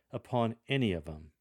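"upon any of 'em" with 'em, no th; relaxed "'em" without the th sound; casual 'em. Shown as a demonstration. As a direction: informal conversational lector read. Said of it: "drop the T H from your pronunciation".

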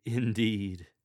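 "Indeed" said loud with a falling tune; emphatic indeed. Shown as a demonstration. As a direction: lean hard on "Indeed". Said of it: "add a throat tremble for added emotion".

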